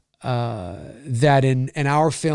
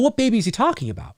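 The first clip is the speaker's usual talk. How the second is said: normal talking voice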